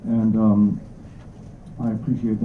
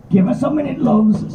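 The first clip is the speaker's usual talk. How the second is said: much higher, squeaky little creature voice; a round little rat-snouted creature just finishing his lunch; fair Jerig voice; 13 semitones higher than usual. Acted.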